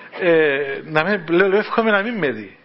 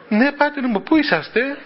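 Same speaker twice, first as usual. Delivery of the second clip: eager question; 7 semitones higher than usual. Retold or imitated. Giorgos asks on the phone.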